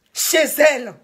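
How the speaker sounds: loud, heated rant at full volume; furious outburst. Real, not acted. Woman denouncing how the caller was treated, furious shouting. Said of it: "là je suis en colère".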